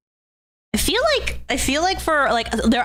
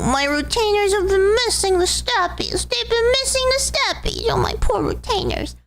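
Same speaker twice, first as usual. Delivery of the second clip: put-on much higher, pouty baby talk; 10 semitones higher than usual. Vtuber cooing high baby talk at her fans. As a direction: coo it like a baby to pets.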